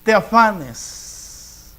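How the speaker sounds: nada drawn out slowly; slow each word down like a divine command; solemn slowed scripture line; slow, weighty quote of the Lord. Acted. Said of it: "El Señor te dice".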